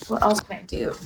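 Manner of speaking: subdued question. Real, not acted. Streamer resigned to bad bathroom lighting, quiet. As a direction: ask it quietly, falling away. Same question, then repeated dramatically.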